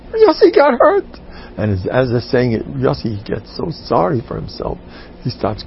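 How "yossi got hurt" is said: put-on high, loud child's voice; excited kid. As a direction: tattle like an eager five-year-old. Kid announces the injury.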